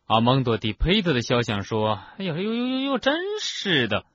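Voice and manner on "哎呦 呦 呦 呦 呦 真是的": very high wailing fussing; shocked, high-pitched grumble; startled, indignant portrait. A narrator's reading. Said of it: "一些肖像又惊又气地叫了起来".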